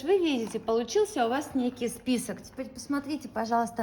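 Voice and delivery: usual speaking voice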